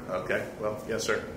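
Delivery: teasing aside. Acted. Teasing reply to offended stranger.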